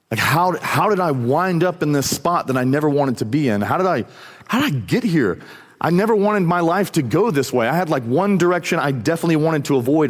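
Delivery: retold waking up to a mistake, mildly distraught; dismayed realization. Peter waking up after the third denial, acted dismay. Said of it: "it's like he wakes up".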